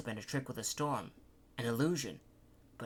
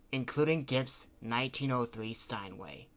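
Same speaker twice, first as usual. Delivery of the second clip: ordinary delivery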